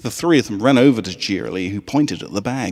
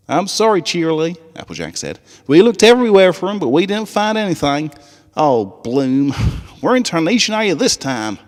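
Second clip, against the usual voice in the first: sorry Southern twang; 9 semitones higher, 6 dB louder than usual. A narrator's reading. Applejack apologizing, Southern accent.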